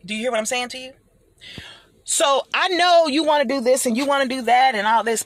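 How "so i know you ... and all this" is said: louder, higher, pressing the point; raised preaching voice. Real, not acted. Speaker urging the crowd, loud and pressing. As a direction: preach it at them with force.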